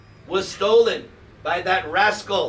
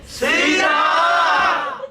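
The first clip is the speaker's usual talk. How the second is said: much higher, louder, long wailing call; anguished cry; 13 semitones higher, 4 dB louder than usual. Pretend. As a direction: show the crowd how to really cry out. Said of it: "Really get into it".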